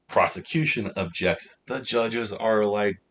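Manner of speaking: normal talking voice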